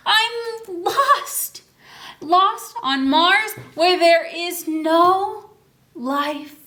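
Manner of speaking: high, slow dismayed cry; dismayed astronaut. Acted. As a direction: wail it out, stranded and shocked. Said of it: "it says".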